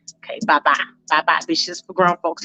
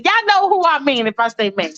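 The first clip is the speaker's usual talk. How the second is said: normal talking voice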